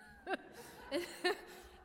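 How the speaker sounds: real chuckle at a quoted joke; short, breathy burst of laughter; amused laugh. Real, not acted. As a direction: laugh at your own punchline.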